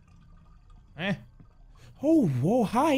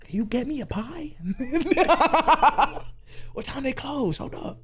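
whisper; 8 semitones higher than usual. Real, not acted.

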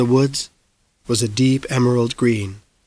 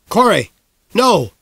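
higher, sharp cry to stop him; urgent shout; 7 semitones higher, 3 dB louder than usual. Acted. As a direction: call out to stop a boy fleeing. Mayor chasing fleeing boy.

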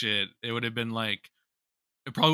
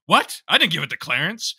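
higher, louder startled denial; shocked denial; 4 semitones higher, 6 dB louder than usual. Retold or imitated. Nick told he gave Clarence the money, higher, louder shocked denial. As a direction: react as if accused out of nowhere. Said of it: "Nick's just like".

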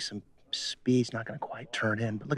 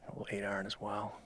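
golf commentator; 9 dB quieter than usual. Acted.

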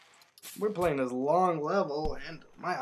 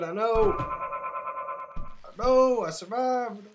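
high-pitched victory cry; excited triumph; 8 semitones higher, 5 dB louder, much slower than usual. Real, not acted. Player who just survived a jump, high excited triumph. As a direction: voice shoots up as you celebrate.

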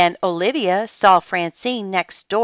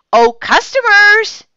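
high, loud, drawn-out singsong call; excited cry; 10 dB louder than usual. A narrator's reading. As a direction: cry out as guests arrive. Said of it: "she cried".

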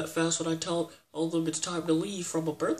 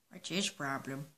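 normal talking voice

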